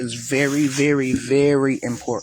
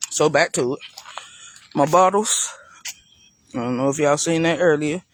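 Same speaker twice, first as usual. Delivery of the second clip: usual speaking voice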